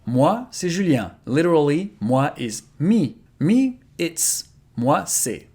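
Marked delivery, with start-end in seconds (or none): 3.4-4.4 high, a bit strange literal "Me, it's"; literal English, played up. Shown as a demonstration. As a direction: stress how odd the literal English sounds. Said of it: "It doesn't sound very natural in English".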